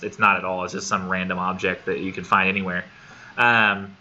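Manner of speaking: ordinary talk, nothing marked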